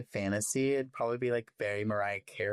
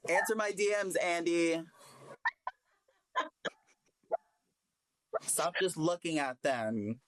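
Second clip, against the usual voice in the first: reality tv confessional; 7 semitones higher than usual. Pretend. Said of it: "Pretend you're in a confessional really quick".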